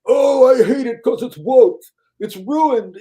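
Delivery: high, loud, mocking whine; whiny critic. Mocking YouTube critics, he whines high and loud. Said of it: "are all going".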